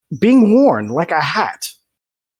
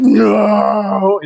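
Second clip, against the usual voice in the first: high, loud cry of no; desperate cry; 8 semitones higher, 5 dB louder than usual. Acted. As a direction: scream as you're dragged off. GM voices victim dragged away.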